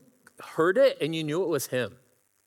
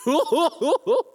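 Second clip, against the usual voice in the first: high, loud cackling laugh imitation; imitated crazy laugh; 13 semitones higher, 8 dB louder than usual. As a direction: mimic the friend's wild laugh. I hear.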